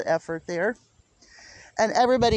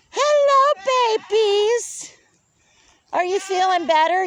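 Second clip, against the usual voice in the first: sing-song pet voice, louder and higher; 10 semitones higher, 4 dB louder than usual. Real, not acted. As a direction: coo up high like greeting a newborn. Greeting baby goats in the pen.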